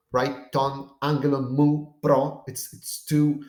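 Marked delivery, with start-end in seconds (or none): 0.5-2.4 "Ton angelon mu pro" with mu and pro each accented; separate word accents. Shown as a demonstration. Teacher demonstrating a clash that doesn't flow, each word accented. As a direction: stress "mu" separately, right before an accented "pro". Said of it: "it doesn't flow".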